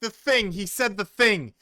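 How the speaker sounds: disbelieving outburst. Real, not acted. Reacting to the cringe line.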